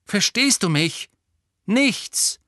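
high, loud stern warning; stern insistence. A narrator's reading. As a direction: drive the point home sternly. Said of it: "sagte Lupin streng".

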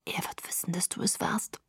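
very soft, voiceless whisper; obedient whisper. A narrator's reading. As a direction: whisper the threat as you were told. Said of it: "flüsterte ich gehorsam". (gehorsames Flüstern)

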